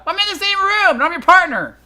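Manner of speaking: high, loud protest; acted indignant outburst; indignant assistant. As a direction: protest hotly in a high girlish voice. Maya protests at being dismissed.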